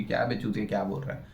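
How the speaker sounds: low, quiet mock cursing; understated imitation of abuse; imitated swearing. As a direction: toss off an imagined heckle, low and soft. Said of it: "गाली बकते उसको ऐसे ही".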